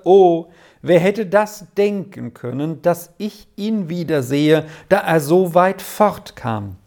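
usual speaking voice

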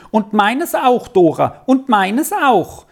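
put-on high, pleading little-boy call; clamouring not to be left out; insistent calling. A narrator's reading. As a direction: keep calling out, pitch jumping up. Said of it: "Jetzt rief er immer zu".

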